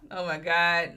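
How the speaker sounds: ordinary delivery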